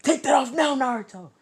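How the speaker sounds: high, loud alarmed yell; acted emergency shout, held high throughout; urgent shouted order. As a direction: scream the command as if fire is spreading.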